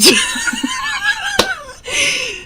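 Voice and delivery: high, shrieking laugh of disbelief; incredulous laugh. Real, not acted. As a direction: laugh helplessly at how insane it is. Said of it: "I'm laughing 'cause I can't believe".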